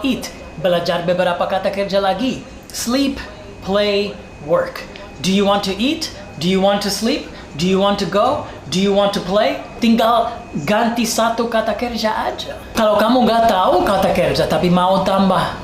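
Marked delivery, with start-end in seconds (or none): none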